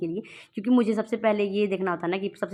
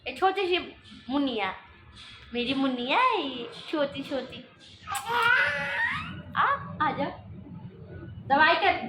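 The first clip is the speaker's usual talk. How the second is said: put-on much higher, sing-song baby talk; cooing baby talk; 8 semitones higher than usual. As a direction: coo to the little one like a doll. Mother coos to her baby. (माँ अपने छोटे बच्चे से ऊँची दुलार भरी तोतली आवाज़ में बात करती है)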